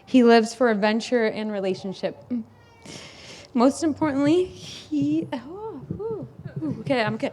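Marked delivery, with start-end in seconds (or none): 4.4-7.3 softer, choked, catching her breath; pulling herself together through tears; overcome with emotion. Real, not acted. Sister's toast, overcome.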